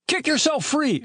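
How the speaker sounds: very high-pitched helpful call; helpful advice. A narrator's reading. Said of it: "advised Chet helpfully".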